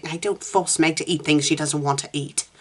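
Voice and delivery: ordinary delivery, nothing marked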